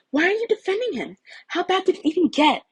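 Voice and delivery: put-on high, loud insistent girl's voice; pressing girl's questions. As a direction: push hard for answers. Uraraka presses Izuku, pitched up.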